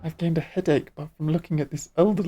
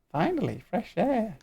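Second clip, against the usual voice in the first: squeaky sylph voice. Acted. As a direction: play a tiny sing-song forest spirit. Voicing a sylph in cutscene.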